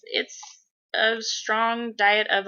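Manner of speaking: normal talking voice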